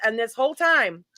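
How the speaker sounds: normal talking voice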